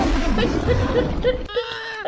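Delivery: loud, sharp shout to drive off a lion; shooing shout. Acted. Passer-by shouts to scare the lion away.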